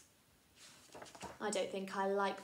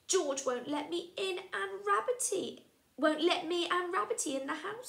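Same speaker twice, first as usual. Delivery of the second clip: put-on higher, plaintive child voice; upset little boy; 9 semitones higher than usual. Freddy telling the twins George shut him out, high and plaintive. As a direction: complain like a hurt small boy.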